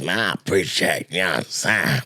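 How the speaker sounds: low-pitched, measured impression with an even bounce; Rico the Great. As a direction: drop low and talk in a steady cadence. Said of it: "his voice sound like that".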